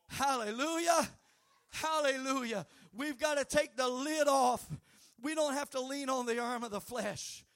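high, loud shouted preaching; fiery preacher shouting to the congregation; full-force preaching. Acted. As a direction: preach it out to the whole church. Preaching continues.

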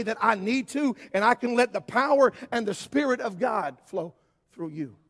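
preaching, rising in force; building sermon. Acted. Preacher urging the church to let go, building sermon. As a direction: climb toward the call.

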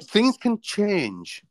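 high, slow drawn-out threat; mock ominous. Host repeating the playful warning to his guest, mock ominous. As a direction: stretch each word, teasing.

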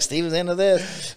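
loud, high mock announcement; mock exposé. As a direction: call it out as a teasing accusation. Imagined gleeful outing post online.

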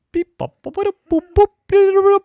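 high, rapid-fire electronic babble; loud staccato robot noises; robot beeping. Acted. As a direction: bleep away like a toy robot. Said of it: "a robot".

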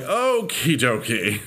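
slow, drawn-out mock cheer; a slow resigned shrug in the voice, lightly done; deflated okie dokie. To her friend about the lame Four Corners visit, slow deflated okie dokie. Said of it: "and now I'm like".

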